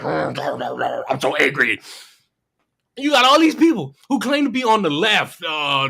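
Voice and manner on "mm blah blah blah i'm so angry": low mumbled mock rant; mocking angry guy. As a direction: drone it like a bored fake-outraged guy.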